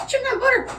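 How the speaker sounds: high-pitched mock outrage; scolding commenters. Streamer mimics commenters objecting to butter, mock scolding. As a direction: squeal it like a scandalised commenter. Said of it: "People are going in the comments".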